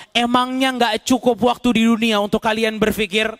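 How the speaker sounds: rising, forceful reproach from the pulpit; preaching rebuke. Acted. Preacher voicing God's answer to the damned, stern reproach. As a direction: scold the audience as God answering.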